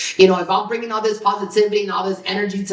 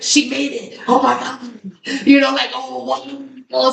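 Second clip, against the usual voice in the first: proud gushing; 6 semitones higher than usual. Retold or imitated. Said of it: "I'm like".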